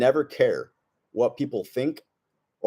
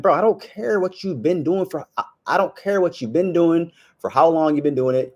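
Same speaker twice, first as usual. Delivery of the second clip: higher, raised retort, pushing back hard; heated retort; 7 semitones higher, 3 dB louder than usual. Retold or imitated. Telling off the fireman with the walkie-talkie, heated. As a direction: snap back at the guy, voice up.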